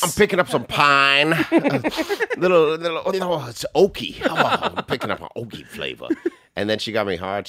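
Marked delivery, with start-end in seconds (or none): 0.0-6.1 high, laughing wine-snob voice; sommelier tasting notes. Pretend. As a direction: sniff out the oak like a connoisseur. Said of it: "talking like a Dick Smollier".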